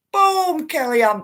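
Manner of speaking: high, loud boom dropping onto the name; excited exclamation. Retold or imitated. Host reacts to dropped sentences, acted excitement. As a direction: burst out like a fan cheering a hit. Acts out delighted reaction to comments.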